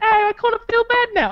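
quick, very high mock feminine voice; high-pitched teasing imitation; imitated friend. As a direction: imitate her guilty second thoughts in a high voice. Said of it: "She's like".

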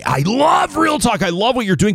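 high-pitched mock fan gushing, "love" held long; imitating gushing fans. Fans praising the show in person, imitated high gushing. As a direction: gush like an adoring listener, pitch way up. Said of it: "people come up to me in person and say".

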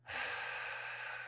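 very soft, long breath out; resigned sigh. Acted. Man about to agree to partner's game, resigned sigh. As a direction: sigh before conceding.

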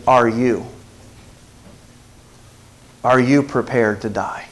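low, soft, searching question; solemn question. Real, not acted. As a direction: drop low and quiet to ask it gravely. Preacher puts solemn question to congregation.

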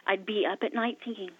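ordinary delivery

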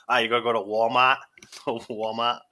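put-on lightly done Boston accent with a dropped r in Walmart, as "Wal-maht"; a friend's mock Boston "Wal-maht", lightly voiced. Friend retold teasing them in a light Boston accent. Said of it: "talking in a Boston accent".

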